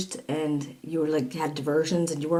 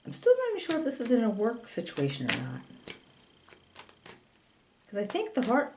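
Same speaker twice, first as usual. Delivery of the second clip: ordinary talk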